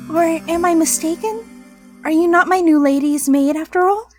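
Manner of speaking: put-on high, hurt little girl voice; a disappointed little girl asking; childish anime girl voice. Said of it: "Childish, I guess?".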